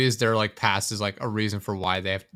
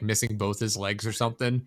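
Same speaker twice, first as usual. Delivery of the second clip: normal talking voice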